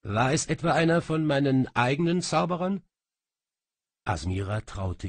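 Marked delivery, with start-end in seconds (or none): 0.0-2.8 quick, higher, louder curt demand; the king asks brusquely, fast and raised; brusque question. A narrator's reading. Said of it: "fragte dann schroff".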